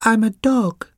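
tiny high puppy voice; very high, little creature voice; extremely small voice. A narrator's reading. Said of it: "it said in an extremely small voice".

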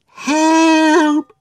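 high, loud cry for help; shouting for help. A narrator's reading.

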